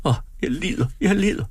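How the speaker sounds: woman in labour gasping her pain; stammered moan of pain. A narrator's reading. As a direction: stammer it out through the pain, rising. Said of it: "Hun stammede".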